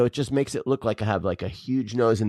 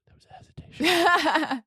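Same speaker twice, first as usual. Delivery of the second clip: whispering. Real, not acted. Teasing whisper after wife's pause.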